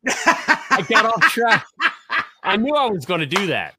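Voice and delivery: cracking up at himself for rambling; laughing. Real, not acted. Laughs at losing his point.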